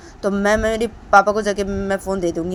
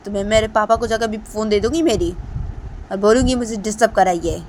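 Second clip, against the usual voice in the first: put-on higher, louder angry girl; angry scolding; 4 semitones higher, 8 dB louder than usual. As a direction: tell him off and hang up. Deepanta angry again.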